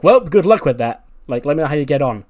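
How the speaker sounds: sarcastic good luck. Retold or imitated. Andrews mocks Aleister's plan.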